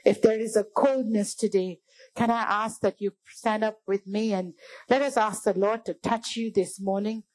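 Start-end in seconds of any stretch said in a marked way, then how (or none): none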